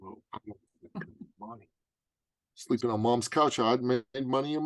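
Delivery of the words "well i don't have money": hushed, unvoiced whisper. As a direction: whisper it, embarrassed and broke. Retold whispered reply.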